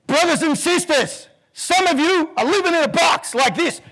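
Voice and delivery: high, loud sermon voice; the reverend rousing the congregation; fiery preacher. Retold or imitated. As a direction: preach it to the whole church.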